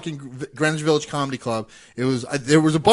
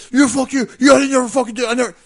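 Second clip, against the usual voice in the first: higher, louder flustered cursing; sputtering rage; 6 semitones higher, 3 dB louder than usual. Retold or imitated. Comic imitates the promoter cursing back, higher and flustered. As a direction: mimic him losing it, spluttering. Retold phone fight with promoter.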